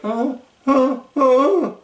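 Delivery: high, stammering mock sob; choking up. Maxine choking up while Candice yells. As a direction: imitate her choking back tears. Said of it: "choking up, holding back tears".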